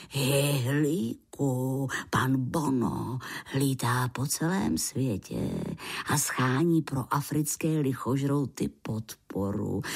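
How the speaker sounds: low, slow grandfather voice; old grandpa. A narrator's reading.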